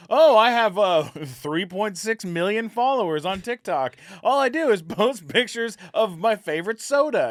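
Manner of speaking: put-on teen influencer. Mimicking teen influencer bragging.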